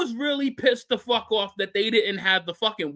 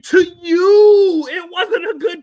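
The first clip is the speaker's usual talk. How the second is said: shouting; 6 semitones higher, 8 dB louder than usual. Real, not acted. Heated argument over the show.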